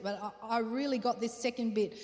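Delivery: normal talking voice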